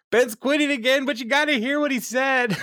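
high, delighted shout to a colleague; Barney calling Ted, thrilled about the joke; excited call. Retold or imitated. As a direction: call him in, bursting with glee.

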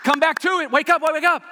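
high, loud repeated calls; urgent wake-up call. Acted. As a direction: jolt them awake. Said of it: "the rumble strip is there to go".

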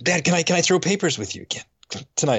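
a lightly voiced little boy asking again; hint of a hopeful child pleading; son asking again. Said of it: "And next night".

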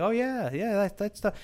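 a hint of a casual dude shrugging it off; knowing boyfriend voice. Boyfriend voiced confirming haunting.

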